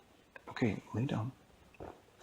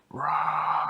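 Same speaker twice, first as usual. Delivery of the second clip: bear roar; 7 semitones higher, 6 dB louder than usual. Retold or imitated. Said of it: "then he went".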